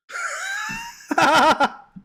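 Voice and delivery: high shrieking laugh-scream. Real, not acted.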